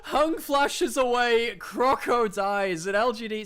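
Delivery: loud, slow, emphatic; dramatic slow call. Real, not acted. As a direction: drag out the kill call.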